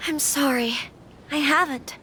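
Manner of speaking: breathy whispered sorry; whispered apology. Acted. Lady Hiiragi whispering an apology to the Traveler, hushed. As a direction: whisper it, barely audible.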